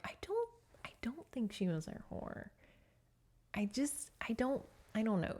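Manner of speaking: whispered, hesitant second-guessing; unsure whisper. Retold or imitated. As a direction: mutter it in a breathy whisper as if doubting yourself. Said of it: "Royce kinda is like".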